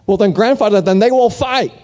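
put-on a small boy pleading with his grandfather; anxious little boy. Boy worriedly asks grandfather.

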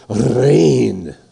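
Reign with a trilled rolled R at the start. Shown as a demonstration. Pastor showing the congregation a rolled R on Reign. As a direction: roll the R of Reign like a Finn. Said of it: "Let's say it like that".

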